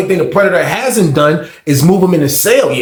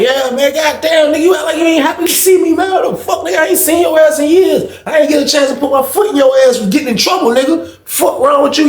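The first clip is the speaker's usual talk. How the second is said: rowdy shouting; 12 semitones higher than usual. Retold or imitated. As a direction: holler at him, half laughing. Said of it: "He was like".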